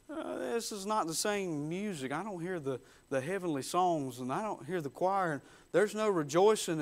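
soft, lightly acted worried thought; uneasy musing. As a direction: think aloud softly, a touch uneasy. Simon's uneasy murmured thought, acted.

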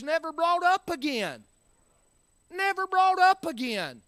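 high-pitched, insistent preaching. Real, not acted. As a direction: ring it out to the congregation.

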